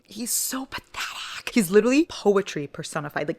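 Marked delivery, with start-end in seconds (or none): 0.0-1.5 slow, breathless whisper of adoration; a hushed, lovesick sigh of a line; swooning whisper. Real, not acted. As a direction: whisper it like you're melting. Swooning over the character Kai.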